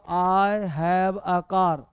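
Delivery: very slow, word by word; teacher dictating each word; slow dictation. Shown as a demonstration. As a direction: spell the sentence out slowly for students. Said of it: "simple बोलो".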